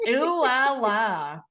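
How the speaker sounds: over-the-top fake French accent, slow and loud; cartoon French accent. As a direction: ham up a cartoon Frenchman, drawing it out. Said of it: "That was awful".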